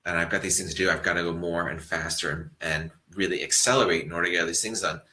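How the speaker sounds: normal talking voice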